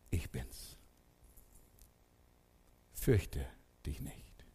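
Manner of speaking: soft whisper, low and tender; Jesus whispering reassurance to the listener; whispered comfort. Acted. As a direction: breathe it to a frightened listener.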